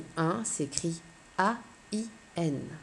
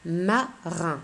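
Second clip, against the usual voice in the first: "Marin" broken in two, Ma, pause, rin; split into syllables. Shown as a demonstration. Teacher starts sorting words with pupils, "Marin" split in two syllables. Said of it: "j'entends bien deux syllabes".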